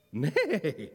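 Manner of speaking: whinny like a horse; rising then falling horse cry; horse neigh. A narrator's reading. Animals scramble into truck.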